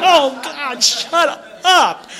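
embarrassed. Retold or imitated. Embarrassed plea to clerk.

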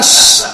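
loud, drawn-out shush; retold shushing. Partner hushing her in a meeting, loud imitated shush. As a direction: shush hard, imitating him. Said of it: "he would say".

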